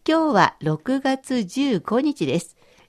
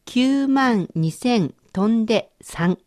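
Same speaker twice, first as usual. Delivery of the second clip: numbers said slowly and evenly; slow dictation; slower than usual. Shown as a demonstration. Teacher reading a number for the learner to translate. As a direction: give the number slowly and clearly. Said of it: "今度は五桁の数字です".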